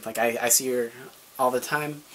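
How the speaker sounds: usual speaking voice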